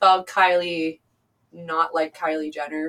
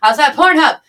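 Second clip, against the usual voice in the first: higher, louder begging; loud pleading; 5 semitones higher, 10 dB louder than usual. Real, not acted. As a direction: beg them to subscribe. Loud plug for her videos.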